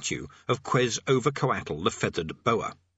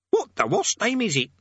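high squawky parrot voice; 11 semitones higher, 4 dB louder than usual. A narrator's reading. Parrot pestering Rincewind about the statue, high squawk.

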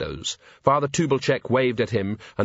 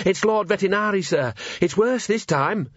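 high-pitched, urgent junior officer; earnest constable; 9 semitones higher than usual. A narrator's reading. Constable at the door telling his commander, urgent. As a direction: report bad news in a high voice. Constable brings alarming news.

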